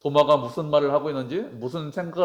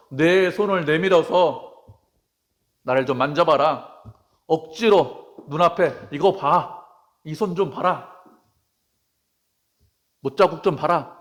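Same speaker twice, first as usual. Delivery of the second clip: higher, slow, insistent acted demand; forceful urging; 3 semitones higher, 5 dB louder, slower than usual. Acting how Jesus did not force Thomas to look, insistent urging. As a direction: press each command on the listener, hammed-up. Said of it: "이렇게 말씀하시는 것이 아니라".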